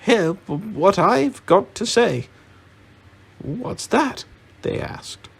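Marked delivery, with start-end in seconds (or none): 0.0-2.3 high, loud, stumbling answer; flustered squeak of self-assertion. A narrator's reading. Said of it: "he was so flustered that he answered". 3.4-4.2 high-pitched questioning; puzzled, rising demand; surprised query. Acted. Dwarves question the flustered hobbit.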